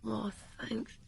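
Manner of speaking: soft unvoiced aside; whisper. Whispering thanks while caller waits on the line. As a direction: breathe thanks off the phone.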